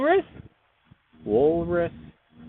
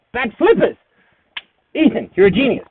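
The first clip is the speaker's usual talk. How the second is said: excited; 7 semitones higher, 8 dB louder than usual. Real, not acted. Sudden idea while drawing.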